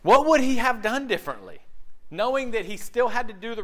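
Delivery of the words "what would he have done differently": preaching emphasis again. Real, not acted. Repeated question.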